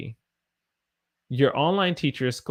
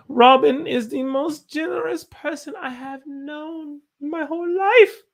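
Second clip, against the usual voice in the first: high read-aloud voice; 5 dB louder than usual. Retold or imitated. Teacher reading a student's sentence in a high put-on voice. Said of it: "reading a student's sentence".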